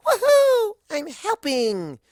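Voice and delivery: high, loud whoop, then a drawn-out cheer; joyful shout. Acted. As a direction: whoop it out with pure glee. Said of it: "shouts Pinky".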